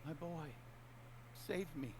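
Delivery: high, pleading ghostly mother; a trapped mother's voice calling for her son. Acted. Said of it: "saying things like".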